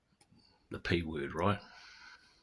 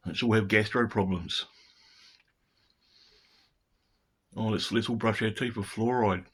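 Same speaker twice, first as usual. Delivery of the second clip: louder, higher mock-cheerful parroting of advice; sarcastic mockery; 4 semitones higher, 4 dB louder than usual. Vlogger mocking official health advice to viewers, sarcastic. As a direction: sneer it like a cheery official jingle.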